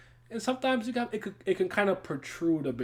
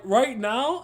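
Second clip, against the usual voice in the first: ordinary talk, nothing marked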